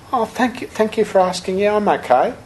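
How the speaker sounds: put-on a frail, grateful old lady thanking him; little old lady. Old lady answers teacher.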